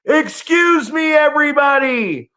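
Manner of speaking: high, loud mock announcement to the whole street; hammed-up shouted call-out, slowed at the start; imitating a scream-out. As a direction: call it out like a public alarm. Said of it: "I love watching him scream out".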